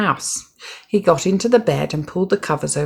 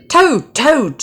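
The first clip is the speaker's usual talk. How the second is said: high, loud call of his friend's name; shouted; 9 semitones higher, 5 dB louder than usual. A narrator's reading. Frog shouting at Toad's door to wake him. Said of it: "shouted Frog".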